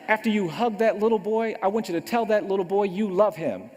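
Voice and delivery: retold parental order, pressed home forcefully; stern mother.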